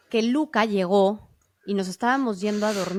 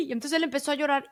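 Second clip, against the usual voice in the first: ordinary talk, nothing marked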